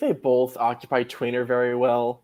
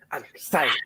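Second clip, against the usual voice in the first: excited; 2 semitones higher than usual. Shown as a demonstration. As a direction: say it out with real excitement. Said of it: "Yeah, I'm excited".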